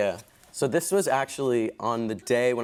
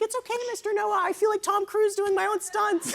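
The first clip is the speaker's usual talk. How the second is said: put-on high, light kid's voice; small boy reassuring his costar; little boy voice. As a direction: chirp it like a cheerful little boy. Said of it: "he's like".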